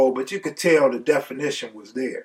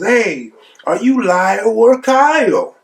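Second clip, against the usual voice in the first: high, loud sing-song taunt; heckling; 8 semitones higher, 5 dB louder, slower than usual. Retold or imitated. Cellmate heckles Lyle for laughs, loud mocking taunt. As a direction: call out mockingly, stretching the last name.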